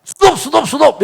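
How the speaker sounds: high, rapid-fire cries of stop; acting out a frantic last-second halt; urgent stop. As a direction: cut in urgently, pitched high. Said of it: "굉장히 급하게 막아버렸습니다".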